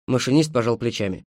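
ordinary delivery

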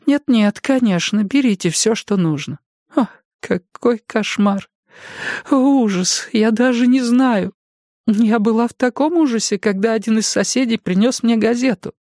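высокий испуганный старческий голос; старушка в ужасе; взволнованная старушка. A narrator's reading. Jenny horrified. (голос потрясённой старушки)

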